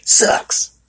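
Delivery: "Sucks!" hissed unvoiced and loud; spat "Sucks!". Mom dismissing her son's playing, "Sucks!" hissed. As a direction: spit out "Sucks!" in a harsh breathy hiss. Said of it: "She said it like that too".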